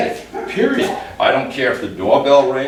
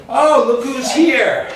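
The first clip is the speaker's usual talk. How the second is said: much higher, slow, sing-song welcome; greeting a visitor; 10 semitones higher, slower than usual. Pretend. Trainer answering the door to a visitor, high slow welcome. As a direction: greet a guest at the door warmly and slowly. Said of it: "so I can answer the door".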